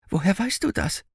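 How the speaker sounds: put-on high-pitched startled boy's question; alarmed boy. A narrator's reading. Finn jumps up to question the old man, alarmed. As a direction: ask it high, startled out of his seat.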